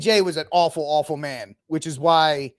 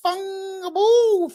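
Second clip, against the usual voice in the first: drawn-out outburst; much slower than usual. Real, not acted. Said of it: "what the".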